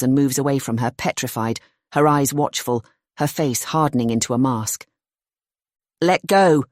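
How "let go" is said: sharp, loud cry to break free; shout. Acted. Girl shouting at Kate to release her, loud. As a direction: yell it and push her off. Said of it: "she shouts".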